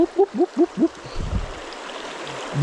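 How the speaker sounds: high whoops trailing off into breath; celebratory whoops. Real, not acted. Angler cheering a caught brook trout, whoops fading. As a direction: whoop it up over the catch.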